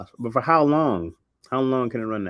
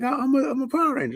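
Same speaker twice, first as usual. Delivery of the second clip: put-on higher, fast, flustered kid insisting; kid friend; 3 dB louder, faster than usual. Friend in a bootleg costume insists, high quick voice. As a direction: insist defensively like a little kid. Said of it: "He's like".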